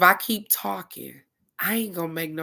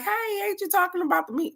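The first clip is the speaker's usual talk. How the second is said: high mock-mom voice, pitched way up; imitating her mom; 13 semitones higher, faster than usual. To her viewers, imitating her mom's question in a high squeak. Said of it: "she like".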